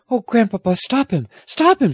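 high, alarmed pleading; crying out. A narrator's reading. Girl begging grandfather to stop James, crying out. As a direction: beg frantically, rising on the repeat. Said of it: "she cried".

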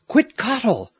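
high, restrained storyteller's shout; narrator's contained shout, pitched high; shouted call. A narrator's reading. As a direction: call the name out high, as if across a crowd. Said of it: "shouted at the top of his voice".

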